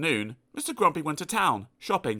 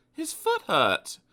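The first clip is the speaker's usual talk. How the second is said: usual speaking voice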